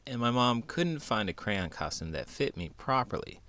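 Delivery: ordinary delivery, nothing marked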